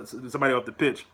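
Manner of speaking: ordinary talk, nothing marked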